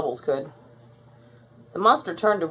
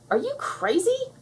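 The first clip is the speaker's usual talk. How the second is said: high, disbelieving question; incredulous. Acted. Buttercup answering Bubbles's wish to keep it, incredulous. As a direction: ask as if it's absurd. Buttercup scoffs at sister's idea.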